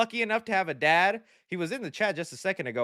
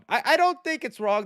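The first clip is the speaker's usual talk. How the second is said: normal talking voice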